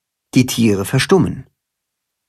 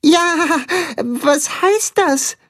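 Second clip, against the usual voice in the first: eager little dog; very high and slow; high puppy voice; much slower than usual. A narrator's reading. Tacho's high voice.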